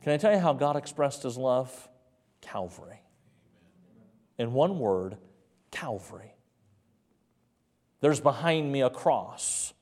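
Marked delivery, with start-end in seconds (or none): 2.4-3.0 very low, hushed single word; hushed reverence. Real, not acted. Hushed answer to own question. 5.7-6.3 one word, very soft and low; hushed reverence. Real, not acted. Hushed word repeated for weight.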